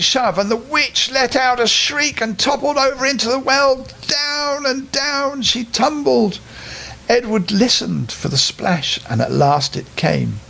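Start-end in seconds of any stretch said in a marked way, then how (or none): 4.1-6.4 high, slow drawn-out narration; slow falling. A narrator's reading. Narrator telling the witch's fall, slow and drawn out. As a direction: stretch the fall out.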